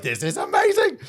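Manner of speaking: high, squealed amazement; excited high squeal; amazed delight. Retold or imitated. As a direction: gush with thrilled wonder. Delighted reaction in retold scene.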